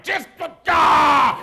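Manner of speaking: loud, high exasperated yell; lunatic noise. Retold or imitated. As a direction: burst into a wordless lunatic yell instead of cursing. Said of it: "I make noises like a lunatic".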